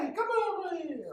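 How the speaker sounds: mock mob call. Mob calling others over.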